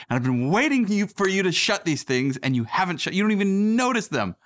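imitating a fed-up wife, pitched high; high-pitched mock scolding, stretching the key words; exasperated. Wife scolds husband over open cupboards, exasperated. Wife's exasperated complaint, acted out.

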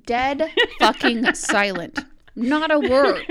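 loud and laughing; laughing through the words. Real, not acted.

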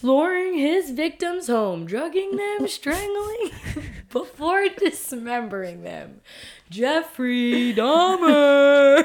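host announcing a serial killer like a boxer; ring announcer. Pretend. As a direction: stretch the name out like a title fight. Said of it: "I loved your, "And in this corner".

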